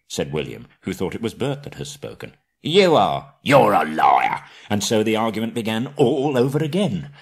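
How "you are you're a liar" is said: loud troll retort; angry troll. Acted. Trolls trading insults, loud. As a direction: throw the insult back hard.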